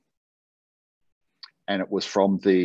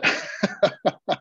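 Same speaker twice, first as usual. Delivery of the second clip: amused laugh; 11 semitones higher than usual. Real, not acted. Laughing at coffee shop deals.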